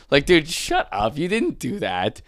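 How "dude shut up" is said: usual speaking voice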